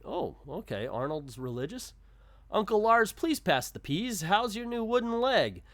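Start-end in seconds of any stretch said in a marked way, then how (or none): 2.5-5.6 put-on higher, louder boy character read; lightly voiced comic-strip boy. Arnold chatting to his uncle at dinner, lightly voiced boy. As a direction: read the boy's questions out high and loud.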